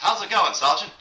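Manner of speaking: put-on old newsreel narrator, high and loud. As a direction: announce it like a wartime newsreel. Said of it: "that great newsreel voice".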